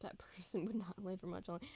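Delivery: hushed ominous whisper. Real, not acted. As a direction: breathe it under your breath.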